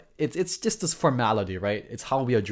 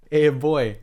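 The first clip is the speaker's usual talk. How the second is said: put-on a louder, punchier Hawaii pidgin "Hey, boy"; Hawaii uncle greeting; 4 semitones higher, 7 dB louder than usual. Uncle phoning his nephew, a hint of Hawaii accent. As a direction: call out like a friendly local uncle. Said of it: "That, that's the Hawaii way".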